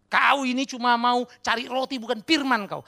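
loud, high, drawn-out scolding; acting out a stern accusation; harsh rebuke. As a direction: rebuke the crowd forcefully. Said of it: "Kalimatnya keras".

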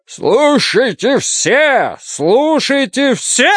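high, slow bellowing cry; town crier. A narrator's reading. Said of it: "набрал полную грудь воздуха и заревел".

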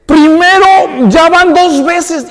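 high, loud, slow furious cry; angry outcry. Retold or imitated. Cheated brother crying out in rage, high and loud. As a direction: shout out the betrayal. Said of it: "Esaú se enoja y dice".

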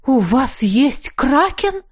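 breathless high amazed question; gasping surprise. Acted. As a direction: gasp it out, amazed. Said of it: "ахнула женщина".

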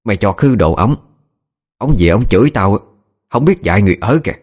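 mild acted tirade; shrieking scolding. A narrator's reading. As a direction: scold the servant, with understated force. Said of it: "Bà ta bắt đầu tru tréo". (giọng bà chủ chửi bới, hơi gắt)

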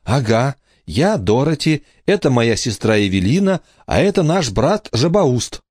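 put-on high-pitched child's voice; brisk high girlish voice; little girl introducing. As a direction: introduce everyone as a perky schoolgirl. Emma introduces siblings by false names.

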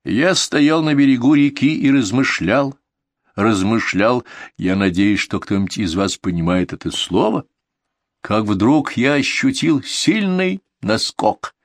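plain reading voice

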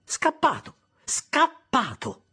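gruff sister snapping the answer; rude. Acted. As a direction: bark it at the boy, dragging the second. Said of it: "precisò con voce rude".

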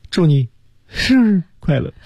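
"祝你生日快乐" broken up, without the lift on "快乐"; halting "祝你生日快乐", "快乐" let drop; wrong intonation example. Shown as a demonstration. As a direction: show how not to say "祝你生日快乐", in pieces. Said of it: "你不能说".

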